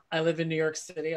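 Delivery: ordinary talk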